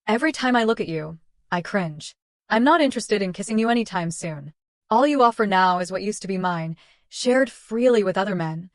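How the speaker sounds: ordinary delivery, nothing marked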